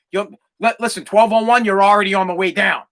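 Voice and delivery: ordinary talk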